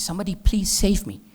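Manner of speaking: storyteller's acted cry for help; mock scream for help. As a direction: imitate a scream for rescue without fully screaming. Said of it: "Drona is screaming".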